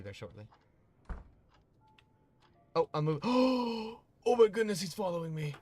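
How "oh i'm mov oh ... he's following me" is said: much higher, louder, slow gasping delight. Real, not acted. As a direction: gasp with joy as the cat follows. Cat follows her, gasps.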